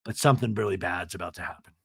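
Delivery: normal talking voice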